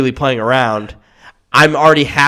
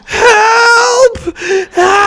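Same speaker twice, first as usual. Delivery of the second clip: high, loud pained cry; screaming for help; 8 dB louder than usual. Retold or imitated. Beaten man in the hallway, high pained screaming. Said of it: "he's just going".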